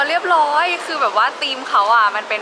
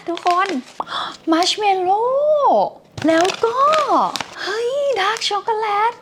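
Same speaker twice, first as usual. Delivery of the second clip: excited; 11 semitones higher, much slower than usual. Real, not acted. Finding snacks in gift box.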